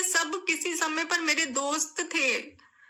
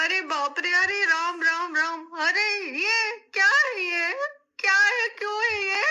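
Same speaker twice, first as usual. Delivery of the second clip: sobbing cry; 3 semitones higher than usual. Real, not acted. Distraught woman defending herself, weeping.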